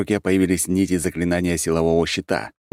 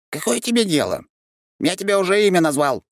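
irritated creature. A narrator's reading. Creature snaps back.

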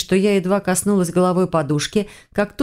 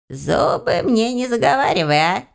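higher-pitched character voice, demanding; businesslike house spirit; 4 semitones higher than usual. A narrator's reading. House spirit cutting off excuses, businesslike demand. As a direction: demand it as a no-nonsense little taskmaster. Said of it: "деловым тоном потребовал домовой".

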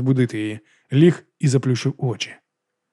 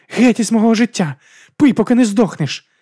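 high, loud angry cry; furious send-off; 10 semitones higher, 6 dB louder than usual. Acted. Girl leaving her boyfriend for good, high furious shouting. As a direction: shout them out of your life. Girlfriend storms out in fury.

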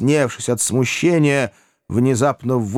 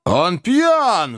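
higher, hammed-up angry cry; furious roar; 9 semitones higher than usual. A narrator's reading. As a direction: bellow at the drunk boy. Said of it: "в бешенстве заревел старик Уордль". (в бешенстве)

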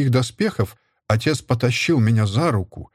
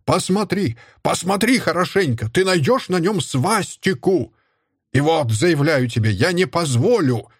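high, furious shouting; angry shouting; 6 semitones higher than usual. A narrator's reading. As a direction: rage at the mother. Отец кричит в ярости. (кричит в ярости, высоким голосом)

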